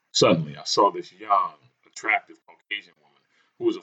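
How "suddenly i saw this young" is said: ordinary delivery, nothing marked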